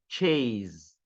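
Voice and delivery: "Chase" with a vibrating z at the end. Shown as a demonstration. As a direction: say "Chase" as "cheyz". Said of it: "Então, som de Z".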